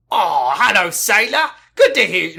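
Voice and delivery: a loud, high mock Doctor voice; Doctor impression. Shown as a demonstration. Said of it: "that's way too grisly and gruffly".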